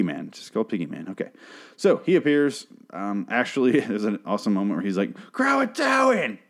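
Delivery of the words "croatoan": high, ringing mock yell of the word; yelled Croatoan. Ashley yells it at the killer, a high mock yell. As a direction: shout it like a spell meant to ward him off. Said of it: "And he yells it at him".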